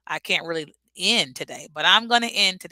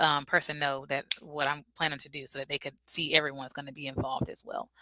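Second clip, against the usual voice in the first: normal talking voice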